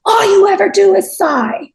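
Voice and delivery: high, loud scolding cry; mock scolding shout. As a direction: yell it at the crowd like an exasperated player. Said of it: "which translates as something like".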